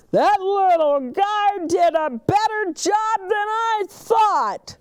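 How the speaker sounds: high, loud big truck voice; Big Buck voice. A narrator's reading. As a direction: boom it out with grudging respect. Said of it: "Big Buck says".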